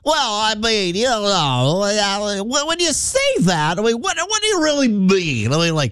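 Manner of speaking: high, far-off put-on voice of a dodging official; mocking a flustered official. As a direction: squeak out an evasive non-answer. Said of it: "and he was like".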